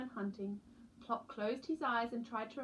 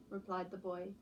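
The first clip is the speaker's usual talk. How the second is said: usual speaking voice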